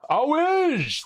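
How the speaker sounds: high, drawn-out name call; excited thank-you; excited shoutout. Real, not acted. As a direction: greet the gifter with a high sing-song call.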